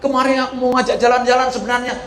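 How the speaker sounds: high-pitched regretful child, acted out; mock wailing lament. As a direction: lament to a dead mother, high and hurried at the end. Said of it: "engkau teriak-teriak, nangis-nangis".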